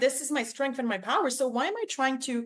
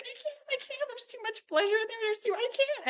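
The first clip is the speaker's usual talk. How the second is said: very high, squeaky voice overcome by giggles; helpless laughing fit; 12 semitones higher than usual. Retold or imitated. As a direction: re-enact being too giddy to get the words out. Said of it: "I just explode laughing".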